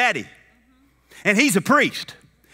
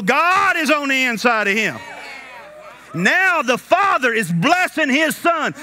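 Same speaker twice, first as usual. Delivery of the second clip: fervent preaching; 4 semitones higher than usual. Real, not acted. Preacher declaring the Father's blessing on his son, fervent preaching. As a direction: proclaim it with long stressed words.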